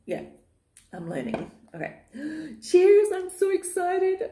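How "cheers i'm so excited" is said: excited cheers. Real, not acted. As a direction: gasp then much higher, louder toast. Toasting with tea.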